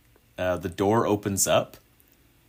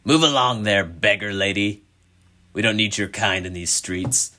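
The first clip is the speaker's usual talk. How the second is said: a touch louder, sneering at a beggar; rude young lad; 2 semitones higher, 4 dB louder than usual. Acted. Young lad to beggar lady, lightly voiced contempt. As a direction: shoo her off like dirt. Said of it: "he says".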